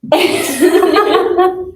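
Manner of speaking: high burst of laughter; quick laugh at the joke; light laugh. Real, not acted.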